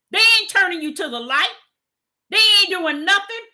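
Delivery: high, loud preacher's cry, rising on each charge; preaching at full force. Acted. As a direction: call out the false leaders from the pulpit.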